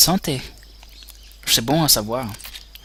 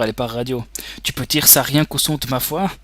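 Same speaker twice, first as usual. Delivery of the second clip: normal talking voice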